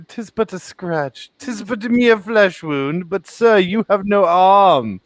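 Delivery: high, hammed-up fake British knight accent. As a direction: bellow it like a mock British knight. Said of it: "Colton was like".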